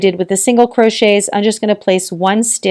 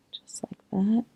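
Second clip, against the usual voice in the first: whisper; 2 semitones higher, 12 dB quieter than usual. Real, not acted. Murmured while working the yarn.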